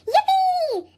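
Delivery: high excited yell of joy; yelled cheer. A narrator's reading. Kids cheering for the snow fort, excited yell. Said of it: "everybody yelled".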